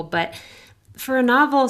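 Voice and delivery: ordinary talk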